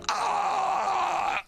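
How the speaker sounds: high mock crowd cheer; crowd roar. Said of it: "The crowd's like".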